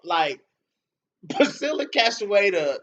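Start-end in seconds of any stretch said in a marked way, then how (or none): none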